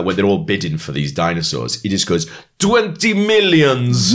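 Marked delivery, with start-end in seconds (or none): 2.6-4.1 high, drawn-out Russian gangster bid; Russian mafia boss imitation, pitched high and slow; mock Russian accent. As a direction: stretch it out in a put-on Russian accent. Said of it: "that is not how you say that".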